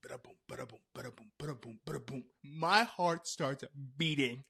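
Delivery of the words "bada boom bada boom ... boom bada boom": whispered rhythmic heartbeat thumps; imitated heartbeat. Storyteller imitating her pounding heart, whispered. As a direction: whisper a soft pulsing beat. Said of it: "that's when my heart starts to".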